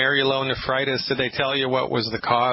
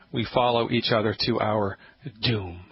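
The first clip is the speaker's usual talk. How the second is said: usual speaking voice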